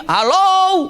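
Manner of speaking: high, loud call of hello; demo shout. Shown as a demonstration. Preacher shows how loud Abbas shouts, high call. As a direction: call it out across the room. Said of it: "Jadi kalau teriak di sini".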